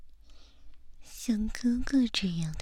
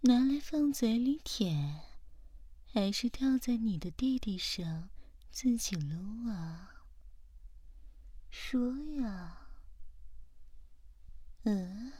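usual speaking voice

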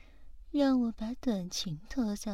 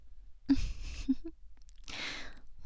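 teasing giggle; 5 semitones higher, 6 dB quieter than usual. Acted. Teasing roleplay laugh.

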